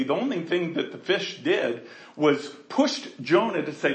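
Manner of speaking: normal talking voice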